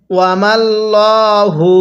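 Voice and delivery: ওয়ামাল্লাহু with a thick, heavy lam, slowly drawn. Shown as a demonstration. Teacher shows students the thick lam in ওয়ামাল্লাহু. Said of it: "লামটাকে মোটা করে পড়তে হবে".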